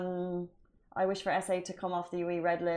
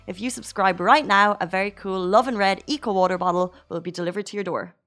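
ad read; 5 dB louder than usual. Acted. Reads sponsor ad.

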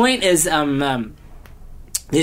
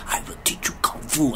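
whispered, softer and lower; hushed quote; 6 semitones lower, 8 dB quieter than usual. Retold or imitated. Retelling the mentor's line to Jaden, in a hushed whisper. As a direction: breathe the line out quietly. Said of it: "he said it with, like".

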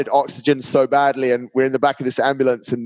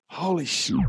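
hushed, higher-pitched retold disbelief; stunned; 4 semitones higher, 7 dB quieter than usual. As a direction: let it out softly, stunned. Retold stunned reaction to her reply.

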